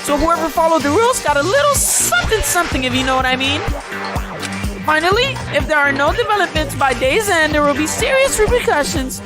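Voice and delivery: sing-song menace like a game show villain; fighting game announcer. Acted. Plays the Killing Game announcer.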